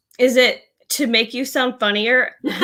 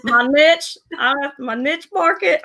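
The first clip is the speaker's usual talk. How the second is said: put-on half-hearted Alabama accent, pitched up; attempted Alabama accent; 4 semitones higher than usual. Host copies co-host's niche, tentative Alabama accent. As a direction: have a tentative go at an Alabama twang. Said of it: "I'm not from Alabama. I'm not even gonna try".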